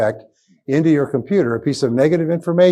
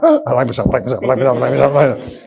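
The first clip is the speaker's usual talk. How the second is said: self-talk; much faster than usual. Shown as a demonstration. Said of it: "You've gotta keep yourself up".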